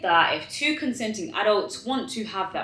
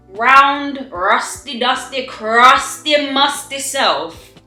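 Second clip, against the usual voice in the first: mocking outrage; 5 semitones higher, 7 dB louder, much slower than usual. Real, not acted. Mocking his message, rising outrage.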